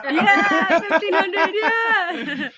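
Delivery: real delighted giggles; laughing. Real, not acted. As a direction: laugh along in disbelief. Host laughs at the surprising total.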